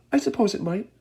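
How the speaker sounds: high, breezy cheerful voice. A narrator's reading. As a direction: shrug it off happily. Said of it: "cheerful as ever".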